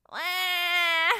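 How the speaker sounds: a high wailing sob; loud wail. Acted. Lost marshmallow wailing aloud. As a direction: let out a big crying wail. Sobbing before loud sobs.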